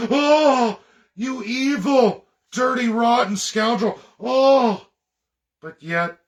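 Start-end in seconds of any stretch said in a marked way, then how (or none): 0.1-4.9 much higher and louder, hammed-up shocked gasp; over-the-top mock horror at a scoundrel; mock outrage. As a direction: ham up a scandalized accuser clutching pearls. Mocking outraged accusers.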